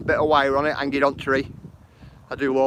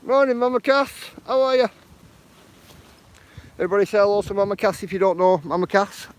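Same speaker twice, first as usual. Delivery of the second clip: bright, raised, upbeat host hello; cheery greeting; 7 semitones higher than usual. Real, not acted. Greeting chat viewer on livestream.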